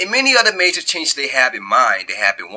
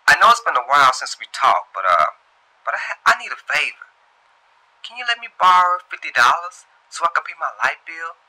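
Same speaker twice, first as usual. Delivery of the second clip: put-on higher, louder, wheedling girlfriend; friend asking a favor; 9 semitones higher, 7 dB louder than usual. Old friend begging Shantae for money, higher, louder, pleading. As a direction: sweet-talk her into lending fifty dollars.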